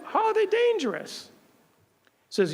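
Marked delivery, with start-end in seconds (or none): 0.1-1.3 startled, higher-pitched retort to the prison captain; incredulous question. Retold or imitated. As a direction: pitch jumps high in disbelief. Baffled reply to banning captain.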